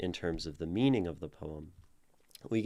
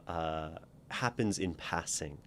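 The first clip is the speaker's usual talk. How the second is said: ordinary delivery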